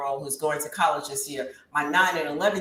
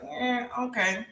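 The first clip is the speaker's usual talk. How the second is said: reluctant hesitation; 3 semitones higher, 5 dB quieter, much slower than usual. Retold or imitated. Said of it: "And I was like".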